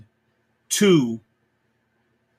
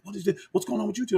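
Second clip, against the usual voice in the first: hushed, higher mock of the elder's questions; imitating frantic Becker; 5 semitones higher, 8 dB quieter, much faster than usual. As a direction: play Becker whispering his panic, pitched up.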